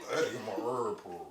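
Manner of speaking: low, fast, slurred trail-off; like a machine shutting off mid-word; powering-down mumble. Retold or imitated. Mocking [name] as if his plug was pulled: low, slurred trail-off. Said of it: "that's too much".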